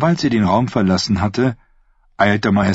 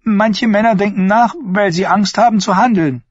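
a touch of biting mockery in the niece's higher voice; Thyene's voice; 13 semitones higher than usual. Thyene taunting her uncle the prince, mildly mocking. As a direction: needle your uncle with sweet sarcasm.

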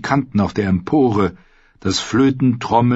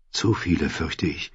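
quiet grim thought; 5 semitones lower, 8 dB quieter than usual. A narrator's reading. Caitlin's grim private thought.

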